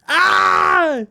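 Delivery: high, loud, held "ah" scream; triumphant scream. Real, not acted. Artist who hid the gun in shadow, triumphant scream. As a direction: scream out in glee.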